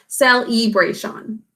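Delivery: "celebration" with clear EE, sel-ee-bray-shan; spelling pronunciation. Shown as a demonstration. Teacher leading chin-tap syllable count, "celebration" as sel-ee-bray-shan. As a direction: pronounce "celebration" in four full-vowel syllables. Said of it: "Fingers under your chin to pronounce this as".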